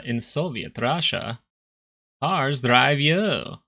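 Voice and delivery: slow mock Russian joke voice; Russian accent. Streamer tries a Russian accent for chat, slow mock Russian. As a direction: drag it out like a stage Russian. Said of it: "What other accents can we do?".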